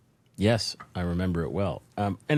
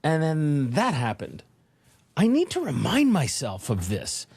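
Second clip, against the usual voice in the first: much higher, amazed and laughing; grinning disbelief; 8 semitones higher than usual. Retold or imitated. Speaker alone after the standing ovation, amazed and grinning. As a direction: marvel at your own success, higher. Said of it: "grinning, like".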